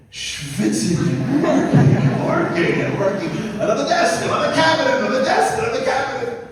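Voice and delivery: loud, high, driving repetition; worked-up storytelling, relentless and high; frantic toil. Retold or imitated. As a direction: pile up the jobs like nonstop labour. Mimics grandfather's frantic nonstop work.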